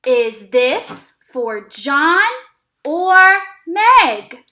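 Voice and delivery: put-on slow, stretched reading for kids; teacher reading a sentence for kids to repeat, slow and clear; slow read-along. Shown as a demonstration. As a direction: read each word slowly and clearly. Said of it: "let's read the sentence one more time together".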